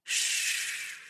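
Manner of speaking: soft whispered hush; shushing. A narrator's reading. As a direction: hush her under the breath. Hushing her.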